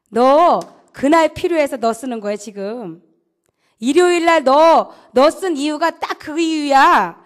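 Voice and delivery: loud, slow commanding voice, not overdone; a battalion commander laying down orders; commander's orders. Retold or imitated. Battalion commander lays down orders to the private, loud and slow.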